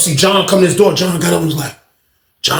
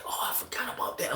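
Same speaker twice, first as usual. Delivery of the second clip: whisper; 6 semitones higher, 21 dB quieter than usual. Retold or imitated. Narrator remembering, under his breath.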